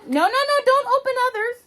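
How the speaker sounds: high, loud urgent stop; sudden alarmed no. Real, not acted. Mother stopping her son opening the calendars, loud. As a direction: stop him fast.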